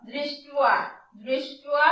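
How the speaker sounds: দৃষ্ট্যা said as dristua; teacher modelling দৃষ্ট্যা; pronunciation demo. Shown as a demonstration. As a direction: say দৃষ্ট্যা as dri-stua, with the sound wa. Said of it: "তাহলে কি হলো?".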